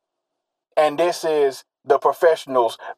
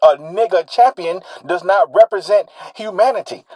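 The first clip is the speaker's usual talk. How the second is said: usual speaking voice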